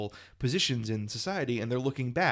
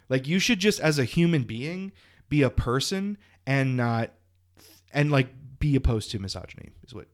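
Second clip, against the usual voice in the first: ordinary talk, nothing marked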